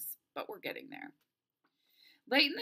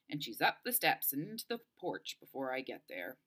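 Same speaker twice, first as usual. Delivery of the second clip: ordinary delivery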